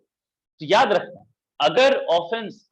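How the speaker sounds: slow emphatic reminder. Real, not acted. Teacher stresses a point.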